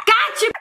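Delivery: high gleeful shriek and shout; shrieking laugh, shouted gotcha. Real, not acted. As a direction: shriek with glee then shout it.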